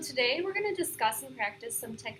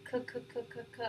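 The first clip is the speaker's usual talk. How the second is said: stutter with pause; 6 dB quieter, faster than usual. Shown as a demonstration. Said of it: "pause in the middle of the stutter".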